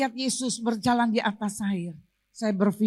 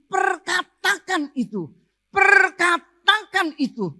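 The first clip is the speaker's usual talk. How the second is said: "Perkatakan" stretched out slowly, louder the second time; emphatic repeated command; 8 semitones higher, 7 dB louder, slower than usual. Real, not acted. Preacher quotes God's word as command, "Perkatakan" slow and forceful. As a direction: drive home "Perkatakan itu", building on the repeat.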